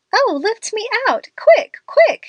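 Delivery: high, loud frightened scream of panic; terrified cry to be lifted out; panicked cry. A narrator's reading. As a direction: shriek for help as the horse stumbles. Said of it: "she cried".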